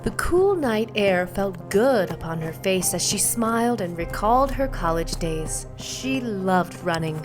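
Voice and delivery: ordinary delivery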